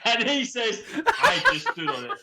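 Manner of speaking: squeaky, loud corpsing; helpless laughter. Real, not acted. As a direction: tell it while cracking up. Storyteller corpsing over his own story.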